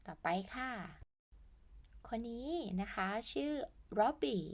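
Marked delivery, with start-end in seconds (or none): none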